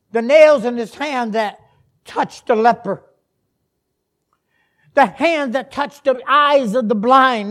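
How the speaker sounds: loud, high preacher's cadence; impassioned preaching. Real, not acted. Preacher describes the crucifixion to the congregation, impassioned preaching. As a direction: proclaim each image with full force.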